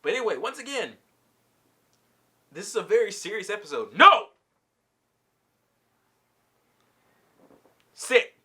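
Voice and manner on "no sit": real loud, abrupt order; loud scolding, each word on its own; sharp command. Real, not acted. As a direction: break off and shout one-word commands. Breaks off to give sharp commands.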